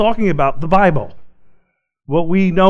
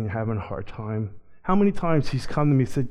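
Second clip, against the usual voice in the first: ordinary talk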